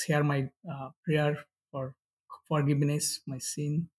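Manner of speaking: ordinary talk, nothing marked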